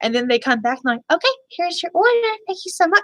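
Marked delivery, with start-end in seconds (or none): 1.1-3.0 high, sing-song fake politeness; the server's bright, fake, cheerful sign-off; overly polite server voice. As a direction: hand over the order with put-on customer-service sweetness. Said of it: "that exact same voice where they're trying to be overly polite".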